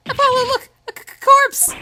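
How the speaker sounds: high, loud, frightened shriek; stammering panic. Acted. Girl showing her partner a body, stammering panicked shriek. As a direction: stutter it out in high-pitched alarm. Girl finds what seems a corpse.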